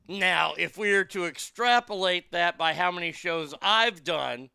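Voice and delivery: usual speaking voice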